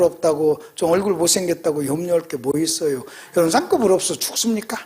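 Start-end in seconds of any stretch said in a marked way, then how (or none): none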